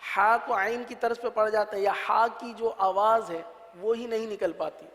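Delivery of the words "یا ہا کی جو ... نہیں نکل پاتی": ordinary talk, nothing marked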